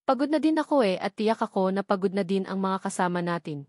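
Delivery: usual speaking voice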